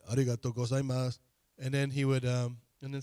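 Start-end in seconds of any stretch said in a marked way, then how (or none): 0.1-1.2 deeper voice, mock. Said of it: "put on your deeper voice then".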